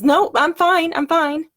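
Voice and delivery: high, loud brush-off; insistent "I'm fine", starting loud and high; defensive reaction. Retold or imitated. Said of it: "my first reaction was".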